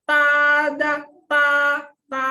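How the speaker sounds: TADA and TA held high and level; loud, long TA on one high pitch, like a surprise TADA; first tone TADA. Shown as a demonstration. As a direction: sustain each syllable of TADA high and even. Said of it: "tinggi terus datar".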